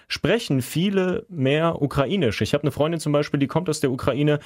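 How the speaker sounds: normal talking voice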